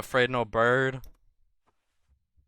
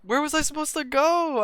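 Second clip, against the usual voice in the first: high-pitched exasperated wail; upset, high-voiced complaint; frustrated protest; 4 dB louder than usual. Real, not acted. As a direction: protest that it was unfair. Protesting after being caught.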